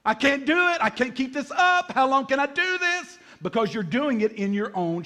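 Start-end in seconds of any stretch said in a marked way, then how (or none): 0.1-3.2 high, strained desperate cry; inner panic. Acted. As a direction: cry out as if about to give up. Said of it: "On the inside, you're going".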